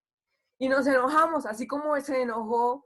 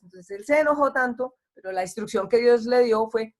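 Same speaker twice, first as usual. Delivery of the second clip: normal talking voice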